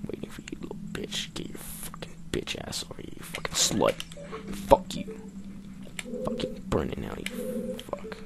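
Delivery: hushed, hissing trash talk while sneaking; stalking prey, whispered taunts; menacing whisper. Real, not acted. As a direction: whisper curses under your breath at the enemy. Whispering threats at game enemies.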